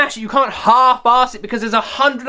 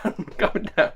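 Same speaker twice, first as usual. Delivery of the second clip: "I'm coming down" said much softer through a laugh; laughing quieter; 3 semitones lower, 8 dB quieter than usual. Real, not acted. Vlogger calming himself after a rant, soft laughing. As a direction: settle down, soft and chuckling.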